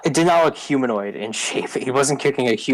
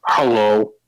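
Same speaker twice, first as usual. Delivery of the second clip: troll greeting. Acted. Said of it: "the troll looks behind him and he says".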